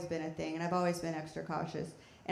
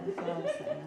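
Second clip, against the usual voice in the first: squeaky repeat. Real, not acted. Repeating punchline in silly voice.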